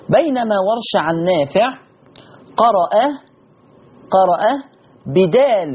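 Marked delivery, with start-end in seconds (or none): none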